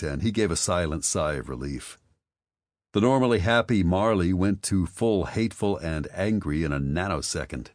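plain reading voice

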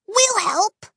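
high, bright-eyed eager offer; eager exclaim. A narrator's reading. Peggy eagerly offering help to the twins, high exclaim. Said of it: "she exclaimed".

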